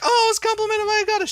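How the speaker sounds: high, fast mocking squeal; mocking gushing voice. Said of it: "don't be like".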